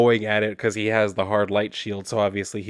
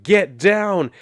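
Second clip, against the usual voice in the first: raised, higher, louder retold shout; urgent warning; 8 semitones higher, 5 dB louder than usual. Woman warning others of the approaching car, urgent shout. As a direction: cry out a quick warning to duck.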